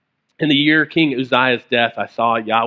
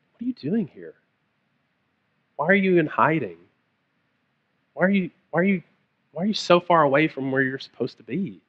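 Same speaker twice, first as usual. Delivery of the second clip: soft, higher, tender questioning with long pauses; quiet, unhurried plea; gentle voice of God; 4 semitones higher, 4 dB quieter than usual. Acted. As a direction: ask it gently, coaxing them out of hiding. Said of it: "But I think a gentle".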